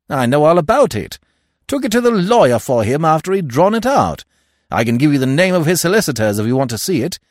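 loud, high, confident Stoner; Stoner, brisk and assured. Acted. Stoner telling about the will, brisk and assured. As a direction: rattle off the facts loudly.

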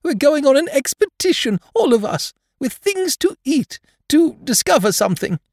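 very high, excited bear voice; excited little bear with emphatic high delivery; Pooh, excited. Acted. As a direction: blurt out big news, pitched right up. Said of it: "said Pooh excitedly".

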